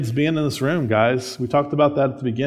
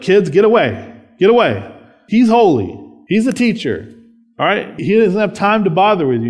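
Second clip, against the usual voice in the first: put-on higher, louder shooing the kids away; shooing disciples; 5 semitones higher, 4 dB louder than usual. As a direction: play the fussy disciples waving kids off. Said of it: "They're like".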